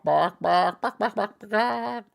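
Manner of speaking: rising high cluck imitation; squawky climbing bok-boks; chicken clucks.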